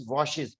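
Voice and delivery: washes said slowly as WOSH-iz; teacher spells out washes slowly, WOSH-iz; pronunciation demo. Shown as a demonstration. Teacher to students: washes said slowly as WOSH-iz. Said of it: "the pronunciation is".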